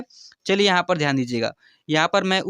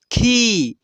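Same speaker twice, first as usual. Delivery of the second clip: खी stretched out, higher and falling; long खी; 4 semitones higher, 4 dB louder than usual. Shown as a demonstration. Teacher drills the long vowel, drawn-out खी. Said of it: "खींच करके नहीं".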